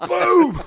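high, loud "boom" effect; big high-pitched boom; imitated punch sound. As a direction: shout out a cartoon punch impact. Mimics his fake punch sound.

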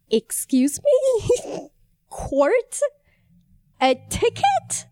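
stunned questions, rising high at the end; disbelieving, with gaps between each question; incredulous. Retold or imitated. Patient to police officer handing over a ticket, incredulous.

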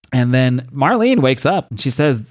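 ordinary delivery

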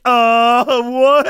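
high, loud, stalled reaction; stunned disbelief. Retold or imitated. Reliving shock at her personal question, stunned. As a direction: blurt it high and baffled.

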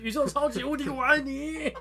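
high, loud yelled move name; attack name shout. Retold or imitated. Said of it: "就是超级宇".

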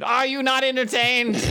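loud, high exasperated plea; exasperated performer. Acted. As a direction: throw it at the crowd, exasperated. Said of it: "I turn to the Face, exasperated".

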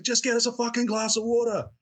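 retold rude customer snapping for water; angry yell. Barman retelling how the customer yelled at staff, angry yell. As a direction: bark it out like a pushy drunk. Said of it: "he's, like, yelling at".

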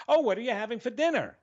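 high, fast dinner question; innocent excited question. Retold or imitated. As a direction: ask brightly in a quick rush. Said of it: "just got off a very exciting phone call".

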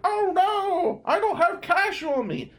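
high, loud mock wail; whiny panicked voice. Annoying classmate pleading at the counter, high whiny wail. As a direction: ham up a panicked student with no cash. You could hear him argue.

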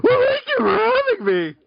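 shrill falsetto thanks; high and loud mock voice; squeaky high voice. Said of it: "you gotta do a sultry voice".

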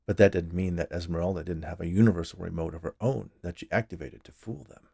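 plain reading voice

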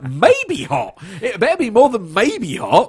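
high, incredulous outrage; indignant. Retold or imitated. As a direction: scoff at the label in disbelief. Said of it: "And you're like".

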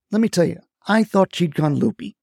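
ordinary delivery, nothing marked

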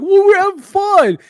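high, loud, slow put-on grumpy dad voice; a fed-up dad forcing the fun. A dad scolding his bratty kid, in a high, loud grumpy voice.

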